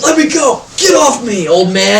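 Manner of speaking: loud, high shouted protest; urgent shout at whoever grabbed you; struggling captive. Acted. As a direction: yell it while wrenching free. Grabbed assassin struggling free.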